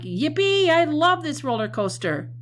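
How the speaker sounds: high, loud thrilled whoop; hammed-up joy, pitched high and loud; excited cheer. Shown as a demonstration. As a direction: cheer like you're riding a roller coaster. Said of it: "Sometimes it's happy".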